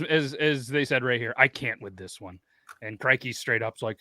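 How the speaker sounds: usual speaking voice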